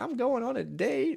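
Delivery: high, slow, singsong announcement; imitated giddy old lady. Old lady telling Howard about her date, mock giddy. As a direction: draw it out in a high, dainty put-on voice.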